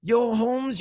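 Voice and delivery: high mock Mexican accent; Carlos Mencia. Comedian starts telling the joke, mock Mexican accent. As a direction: put on a cartoonish Mexican accent. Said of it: "his own Mexican accent".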